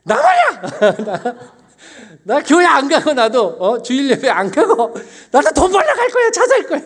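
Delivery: 높고 크게 터뜨리는 성난 고함; 어머니에게 버럭 대들던 자신을 과장해서 재연. Retold or imitated. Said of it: "정말 뚜껑이 열려가지고 저희 어머니한테 그랬어요". (뚜껑 열려 고래고래 소리치는 흉내)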